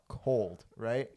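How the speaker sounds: ordinary delivery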